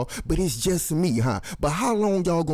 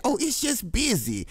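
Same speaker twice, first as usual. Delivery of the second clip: mocking naive listener; 10 semitones higher than usual.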